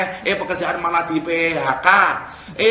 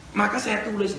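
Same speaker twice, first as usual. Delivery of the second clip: normal talking voice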